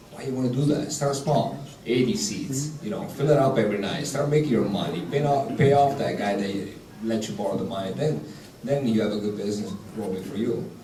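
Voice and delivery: ordinary delivery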